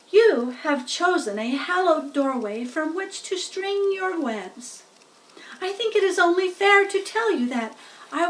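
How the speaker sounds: high, loud formal little speech; solemn speech. Acted. Wilbur makes a formal speech to the spiders, solemn and loud. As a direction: speak with ceremony. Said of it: "a short speech".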